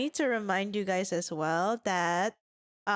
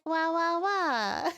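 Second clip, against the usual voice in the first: cutesy singing wahs; 7 semitones higher, slower than usual. Pretend. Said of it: "it goes like".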